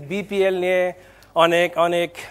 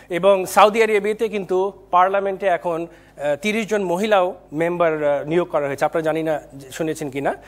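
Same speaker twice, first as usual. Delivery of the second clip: usual speaking voice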